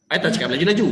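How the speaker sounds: "laju-laju" rushed, rising at the end; quick talk. Shown as a demonstration. Coach repeats the contrast, "laju-laju" said quickly. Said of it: "Nampak tak beza dia?".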